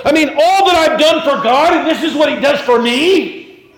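high-pitched, loud protest; indignant complaint. Acted. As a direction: wail it like someone wronged by God. Acted complaint of someone in trouble.

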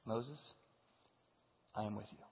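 soft, low steady promise; calm reassurance. Acted. God answers the frightened Moses, soft and calm. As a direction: reassure him quietly.

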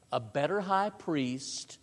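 usual speaking voice

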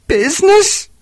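high, loud anguished cry; ghostly cry. A narrator's reading. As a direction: cry out the word in pain. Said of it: "cried the ghost".

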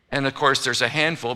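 ordinary talk, nothing marked

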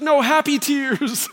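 high, drawn-out mock-weepy reassurance; imitated tearful wife. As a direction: play the wife happily welling up. Retold wife tearfully reassuring husband.

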